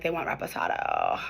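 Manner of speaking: low, quiet mock of the owner; mock of the mad owner. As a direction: drop low and grumble it like the boss. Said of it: "she was, like, so mad".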